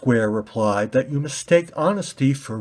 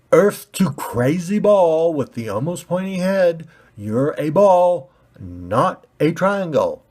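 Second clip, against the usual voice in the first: condescending; 6 semitones higher, 5 dB louder than usual. Acted. Red ball talking down to pointy ball, louder condescending. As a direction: spell it out to a fool.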